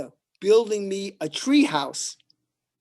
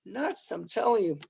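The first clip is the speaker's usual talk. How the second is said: ordinary talk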